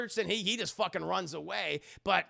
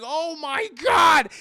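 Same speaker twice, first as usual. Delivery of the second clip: high, loud, slow horrified shout; terrified cry; 9 semitones higher, 13 dB louder, much slower than usual. Retold or imitated. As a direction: scream in terror at the fire. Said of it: "And it's like".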